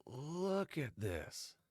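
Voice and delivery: slow, breathy, appalled; stunned disbelief. Real, not acted. Vlogger appalled by a stranger's texts, slow and stunned. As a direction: drag it out in disbelief.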